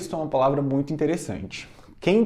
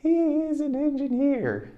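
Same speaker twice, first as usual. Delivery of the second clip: much higher, slow echo voice; high slow drill; 12 semitones higher, slower than usual. Shown as a demonstration. Teacher drills the line in a high slow voice. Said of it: "Repeat after me".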